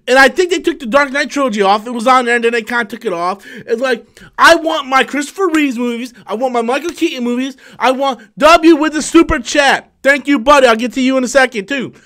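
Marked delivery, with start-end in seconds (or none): none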